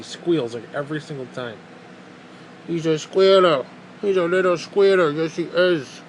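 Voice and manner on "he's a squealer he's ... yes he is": doting pet talk. Real, not acted. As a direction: gush over the dog. Cooing over the squealing dog.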